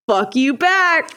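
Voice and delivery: high, drawn-out imitation of a dad; cheery mock retort, rising at the end; playful comeback. Imitates dad's loving comeback.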